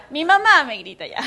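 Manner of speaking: loud, laughing confession. Real, not acted. Preacher joking about her own mother, loud and laughing. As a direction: blurt it out loudly through laughter.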